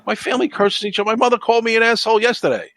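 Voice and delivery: high, loud and fast, laughing at the absurdity; exasperated disbelief, voice climbing high and loud; worked-up rant. Real, not acted. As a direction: push it up like you can't believe it. Ranting about family feuds.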